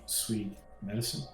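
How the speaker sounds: normal talking voice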